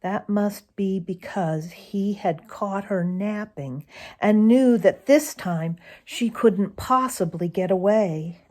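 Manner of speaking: plain reading voice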